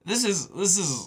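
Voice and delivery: ordinary delivery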